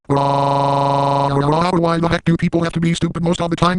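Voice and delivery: high-pitched mock meltdown, stammering nonsense; spaz rant. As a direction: lose it completely in a squeaky, unhinged fit. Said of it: "I will spaz at some of these comments".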